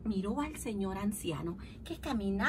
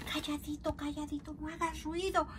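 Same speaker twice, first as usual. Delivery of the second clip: susurrando; 4 semitones higher, 6 dB quieter than usual. Acted. Pedro y Paco se acercan a escondidas.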